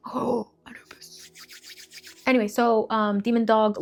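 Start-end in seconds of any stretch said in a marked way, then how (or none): 0.1-1.2 soft whispered Oh, Anubis; whispered aside. Real, not acted. To herself, the name finally coming, a whisper. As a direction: whisper it to yourself as it clicks.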